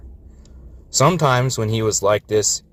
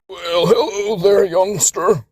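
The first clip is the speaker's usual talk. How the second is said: put-on higher, slow old-timer greeting; old engine; 8 semitones higher, slower than usual. A narrator's reading. Florida addressing Texas, slow old-timer voice. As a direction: greet the youngster like a granddad. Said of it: "Florida addressed Texas".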